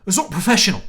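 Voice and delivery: put-on loud, high British accent with glottal stops. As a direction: burst out in glottal-stopped British English. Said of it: "then you hear him talk, he's like".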